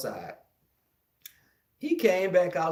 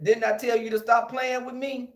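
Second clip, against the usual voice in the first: high, louder threat; threatening kid; 8 semitones higher, 4 dB louder than usual. Retold or imitated. Little boy with a pipe warning the bully. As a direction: corner him and warn him. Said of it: "And I said".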